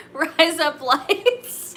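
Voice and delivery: high, stretched-out words between giggles; real laughing fit. Real, not acted. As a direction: squeeze it out slowly through laughter. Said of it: "Now I'm laughing".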